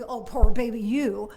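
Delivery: loud mocking pity; sarcastic. Shown as a demonstration. Showing the wrong way to answer a friend's whine, loud and sarcastic. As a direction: throw it back with a sneer. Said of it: "Not sarcastically".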